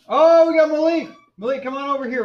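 high, loud greeting call; excited calling out. Real, not acted. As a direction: hail a newcomer across the room. Spots newcomer and calls him over.

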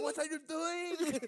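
high, loud, drawn-out yell; hammed-up agonized boxer shout; Rocky Balboa impression. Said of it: "impersonation of Rocky Balboa".

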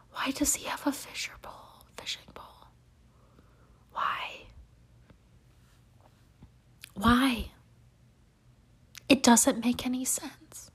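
hushed, voiceless whisper; baffled whisper. Real, not acted. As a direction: whisper it in disbelief. Baffled whispered aside about fishing pole.

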